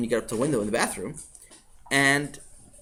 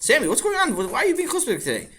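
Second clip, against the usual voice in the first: higher, questioning voice; concerned grown-up; 11 semitones higher than usual. Acted. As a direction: ask the boy what's wrong. Another figure questions Sammy.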